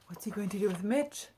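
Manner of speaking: usual speaking voice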